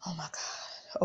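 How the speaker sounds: breathless whispered gasp; hushed panic whisper; shocked gasp and whisper. Retold or imitated. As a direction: gasp in quiet horror. Panicked gasp at lost order.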